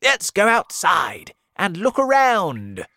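put-on old grasshopper. A narrator's reading. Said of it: "the old green grasshopper said".

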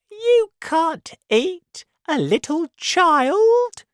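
high, loud, slow protest; shocked storybook monkey voice; horrified monkey. Acted. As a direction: cry out in disbelief, stretching the last word.

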